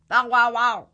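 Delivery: loud, high mock dog bark. The frightened dog barks loudly in the tree.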